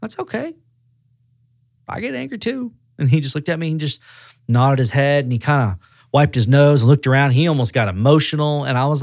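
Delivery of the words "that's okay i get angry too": soft, high-pitched, gentle comforting; retold reassurance. Manager calming an angry customer, gently reassuring. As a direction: ease him down, much softer and higher.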